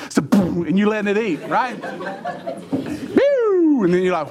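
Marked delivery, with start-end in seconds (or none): 2.7-3.8 imitated zoom. Car zooming sound.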